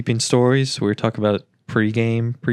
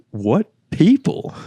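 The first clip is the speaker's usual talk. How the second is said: slow baffled; 7 semitones higher, 5 dB louder, much slower than usual. Retold or imitated. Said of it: "I'm like".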